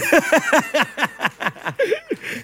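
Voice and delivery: high, loud burst of laughter; big laugh. Real, not acted. Pastor cracking up at his own meme joke, laughing.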